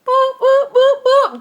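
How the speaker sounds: high, loud cartoon boops; high loud boop noises. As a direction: bleep out boop boop like a meme. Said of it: "That was me to the police".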